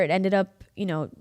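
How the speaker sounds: ordinary talk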